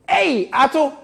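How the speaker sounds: high, loud laughing call; catchphrase shout. Pretend. Host hails Ato with a loud laughing shout. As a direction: call out Ato across the room.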